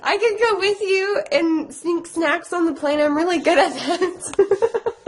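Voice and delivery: high-pitched mock-tearful plea; hammed-up whiny begging; retold pleading. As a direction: plead up high, breaking into laughter at the end. Retells own pleading to go along.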